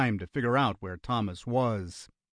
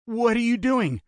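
high, alarmed question; panicked; 11 semitones higher, 4 dB louder than usual. A narrator's reading. Brother following the gunman indoors, panicked. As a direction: ask with rising panic in the voice. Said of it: "Panic had returned to his voice".

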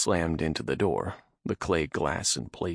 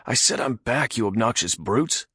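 raised, higher angry shout; angry yelling; 5 semitones higher, 4 dB louder than usual. Acted. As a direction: yell at the brutes. Nath yelling at guards.

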